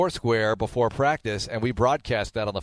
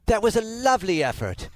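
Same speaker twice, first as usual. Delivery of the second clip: English-accented sports commentary, lifted pitch; higher-pitched English accent praise; 9 semitones higher than usual. Shown as a demonstration. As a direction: say it like an English announcer. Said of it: "things you can say sports-wise with an English accent".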